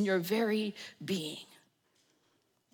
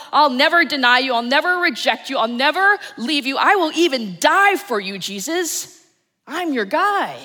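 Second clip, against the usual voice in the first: fervent protest; 3 semitones higher, 7 dB louder than usual. Acted. Peter protesting to Jesus, loud and fervent. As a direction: swear it to him, louder and louder.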